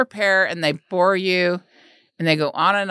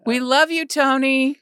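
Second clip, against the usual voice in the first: higher, lilting call to someone absent; playful calling out; 6 semitones higher, slower than usual. Pretend. Hosts calling to an absent comic, playful and high. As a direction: call out with raised pitch.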